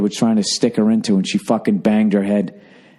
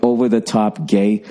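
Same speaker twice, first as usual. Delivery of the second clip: ordinary talk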